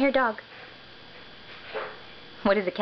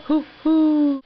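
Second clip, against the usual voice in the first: higher and louder playful hoo hoo; owl hoot imitation; 9 semitones higher, 6 dB louder than usual. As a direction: hoot like a friendly owl for the child. Parent voicing an owl.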